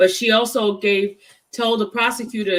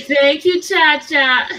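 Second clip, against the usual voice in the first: excited; 7 semitones higher, 7 dB louder, slower than usual. Real, not acted. Warm loud thanks to friend.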